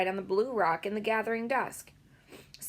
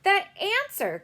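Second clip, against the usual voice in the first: high, loud triumphant cry; excited girl cries out; cried; 13 semitones higher, 7 dB louder, slower than usual. A narrator's reading. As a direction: shout out the answer. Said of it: "cried Sophie".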